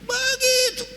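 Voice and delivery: high "Begitu" then a lightly voiced teacher's question; mildly teasing teacher; teacher's remark. Preacher imitating the teacher questioning last week's failure.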